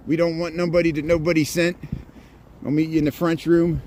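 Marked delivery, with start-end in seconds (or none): 0.1-1.8 Chicago accent. Shown as a demonstration. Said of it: "what does my accent sound like".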